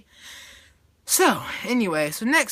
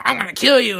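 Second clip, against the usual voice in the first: loud, higher-pitched pretend fury; mock threat; 4 semitones higher, 8 dB louder than usual. Girl beating him up, mock furious threat. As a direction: yell it as a hammed-up threat. Acting attacker threatening victim.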